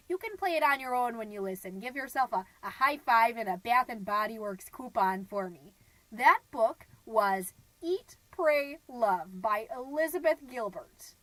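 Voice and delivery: ordinary delivery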